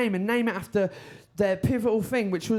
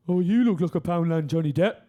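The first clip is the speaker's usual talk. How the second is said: thick Brummie accent, falling inflection; Birmingham accent; 2 semitones lower, 4 dB louder than usual. Shown as a demonstration. Comedian demonstrating how the insult sounds back home, Birmingham accent. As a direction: put on a broad Birmingham accent. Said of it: "But in the Birmingham accent".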